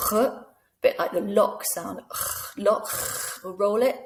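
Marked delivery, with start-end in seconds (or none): 0.1-2.9 "R" and "lock" with a rasp at the back of the throat; throaty French R. Shown as a demonstration. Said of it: "back of your throat".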